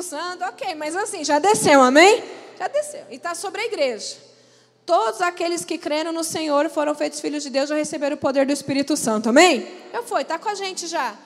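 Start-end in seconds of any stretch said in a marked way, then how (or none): 1.2-11.1 loud, driving preacher's appeal; rising revival-style exhortation; fervent preaching. Acted. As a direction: preach it out with full force. Preaching climax to congregation.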